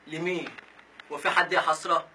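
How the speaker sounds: normal talking voice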